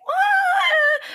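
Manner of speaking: sudden high mock shriek; mock scream. Fan mocking Susan's constant screaming, high mock shriek. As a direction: squeal like a scared girl. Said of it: "then she's like".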